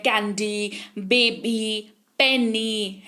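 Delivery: "कैंडी, बेबी, पेनी" each in two distinct beats; measured "कैंडी, बेबी, पेनी", drawing out the final ee; two-syllable demo. Shown as a demonstration. Teacher listing two-syllable words, "कैंडी, बेबी, पेनी" sounded in parts. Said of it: "दो syllable words इनको कहते हैं".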